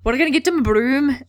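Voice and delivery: hammed-up Scottish lilt; mock Scottish accent. Wood rejoices over his new seeker, mock Scottish accent. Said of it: "that's just McGonagall and not, um, Wood".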